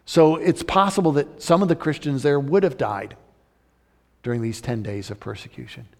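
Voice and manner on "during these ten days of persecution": ordinary talk, nothing marked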